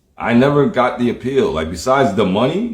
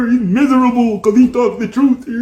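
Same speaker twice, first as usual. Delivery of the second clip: high, squealing mock-tearful falsetto; mocking mom; 12 semitones higher than usual. Critics calling him miserable, mocking high mom-like voice. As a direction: imitate a scolding mom in a silly whine. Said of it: "Sound like my fucking mom".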